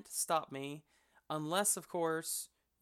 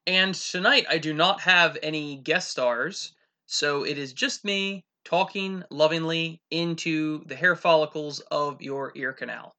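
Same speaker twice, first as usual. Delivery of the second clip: loud, hammed-up radio host; talk show host persona; 14 dB louder than usual. Host [name] addresses listeners, loud hammed-up host. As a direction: blare it like a brash late-night show host. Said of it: "This is Real Talk with the [name]".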